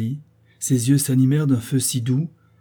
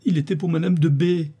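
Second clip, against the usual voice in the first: higher-pitched wounded cry, acted; pained exclamation; 6 semitones higher than usual. A narrator's reading. Sophie learns the portrait was for a rival, exclaiming painfully. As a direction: cry out in hurt reproach. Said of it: "s'écria Sophie d'un ton douloureux".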